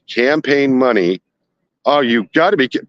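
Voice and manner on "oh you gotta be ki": ordinary delivery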